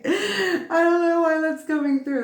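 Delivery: giggling at her own odd thought; high laughing aside. Real, not acted. Laughs at a New York accent.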